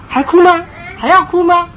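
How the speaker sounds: loud, high threatening question; stern challenge. Retold or imitated. Parent holding the stick, loud threatening challenge. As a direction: dare the child to keep crying.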